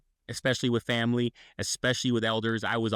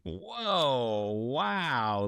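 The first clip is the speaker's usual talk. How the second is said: drawn-out, swooping amazement; stunned reaction. Retold or imitated. Recalling a friend's mom's text, stunned. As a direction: stretch it out, taken aback.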